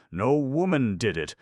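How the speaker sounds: No woman did it! loud and high, woman hit hard; emphatic exclamation. A narrator's reading. Vance insisting to Markham, emphatic and loud. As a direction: proclaim it, stressing woman.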